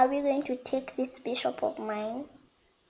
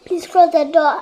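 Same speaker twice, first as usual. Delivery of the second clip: louder call across to someone; 3 semitones higher, 8 dB louder than usual. Real, not acted. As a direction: raise your voice to get the door shut. Calls to someone about the door.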